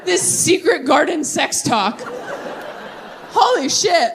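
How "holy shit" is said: laughing awe. Real, not acted. Overwhelmed by sweet story.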